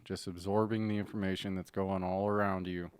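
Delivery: normal talking voice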